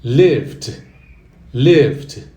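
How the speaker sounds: "lived" said loud with the stress at the start; "lived" said loud and clear; stress on first syllable. Shown as a demonstration. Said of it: "a força continua no comecinho".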